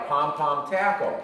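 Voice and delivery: normal talking voice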